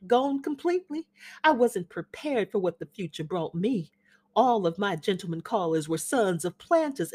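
flutter and fuss over the gentleman caller; faded Southern belle charming her son's guest; gushing Southern mother. Acted. Amanda continues her hostess chatter.

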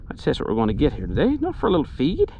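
usual speaking voice

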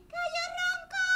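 crowed high like a rooster; high mock crow. Hen yells rooster's nickname.

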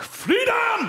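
a high, soaring yell of Freedom; Braveheart battle cry. Retold or imitated. Alarm clock blaring the Braveheart freedom yell, soaring high shout. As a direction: bellow it like the film's war cry. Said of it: "my Braveheart alarm clock".

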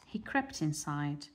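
ordinary delivery

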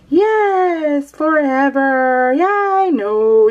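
high, slow sing-song drawl, stretching every word. Shown as a demonstration. Explaining sing-song talk to Tim, high and slow and stretched. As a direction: stretch the words out like a lilting TV judge's pet peeve. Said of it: "when someone talks and they're like".

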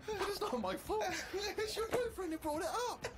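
high-pitched, half-laughing indignation; indignant rant. Retold or imitated. Steve protesting to the girlfriend, high half-laughing indignation. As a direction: protest as if it's grossly unfair. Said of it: "by now I was just furious".

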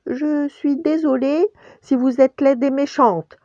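high, timid rabbit apology; balbutiement. A narrator's reading. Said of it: "balbutia Pampan". (petit lapin qui balbutie)

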